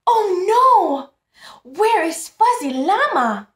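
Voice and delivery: high, loud cry of alarm; panicked llama. Acted. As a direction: wail for the lost toy. Llama misses his toy.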